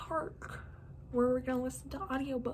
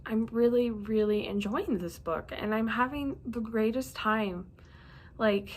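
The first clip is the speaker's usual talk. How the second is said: ordinary delivery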